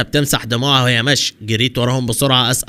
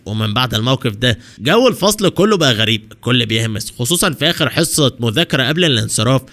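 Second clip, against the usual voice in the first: ordinary delivery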